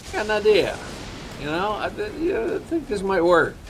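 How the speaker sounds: high-pitched henchman hatching a plan; scheming henchman. Acted. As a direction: pitch it up, as if an idea just struck. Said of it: "that guy behind him is like".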